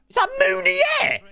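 very high squeak of surprise; high startled squeak; shocked disbelief. Real, not acted. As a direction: yelp the question in disbelief. Surprised at a player appearing.

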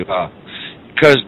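ordinary talk, nothing marked

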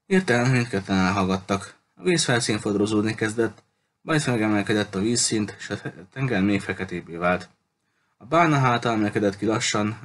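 ordinary delivery, nothing marked

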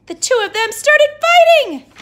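very loud, high climax; excited exclamation. Acted. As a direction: shout the big moment. Narrator's big climax.

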